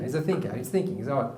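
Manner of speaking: normal talking voice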